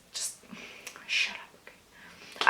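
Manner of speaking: slow whisper to herself; whispered aside. Real, not acted. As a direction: hush it out under her breath. Telling herself off about the bag.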